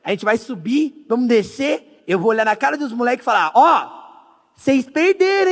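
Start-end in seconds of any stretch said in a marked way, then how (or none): 3.5-5.5 higher, drawn-out gloating; mock taunt. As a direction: taunt the ones who stayed behind. Ensaia a provocação aos moleques. (provocação encenada, aguda e arrastada)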